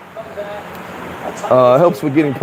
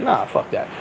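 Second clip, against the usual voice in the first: unvoiced, much softer mock mutter; muttered refusal; 8 semitones higher, 7 dB quieter than usual. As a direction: toss it off under your breath like the stubborn cop. Retold cop refusing to leave.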